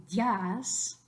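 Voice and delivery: DÍAS squashed to "dyahs"; one-syllable días. Shown as a demonstration. Teacher showing what not to say, días as "dyahs". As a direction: glide I into a long A in días. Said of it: "we don't wanna say".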